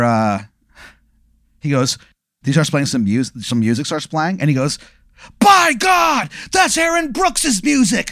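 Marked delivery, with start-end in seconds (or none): 5.4-8.1 shocked high announcer shout; wrestling announcer. Retold or imitated. Retelling the announcer's stunned ringside call, in a high shout. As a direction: yell it like a stunned ringside commentator.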